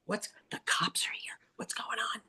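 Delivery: whispered alarm among clients. Retold or imitated. Clients whispering to each other when the cops arrive. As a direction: hush it like nosy onlookers. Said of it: "They were like".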